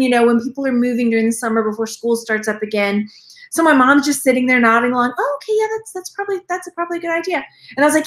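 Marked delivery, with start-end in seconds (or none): 5.2-7.4 imitating mom. Mom's reaction retold.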